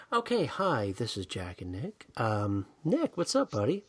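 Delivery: abruptly calm, mock intro; suddenly composed, dipping low and soft; different show-host voice. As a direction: drop the fight and greet listeners smoothly. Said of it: "it's a bit different voice".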